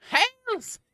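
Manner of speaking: high, loud ritual cry; hammed-up cultist hail; mock hail shout. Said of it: "we're hailing Satan, everyone".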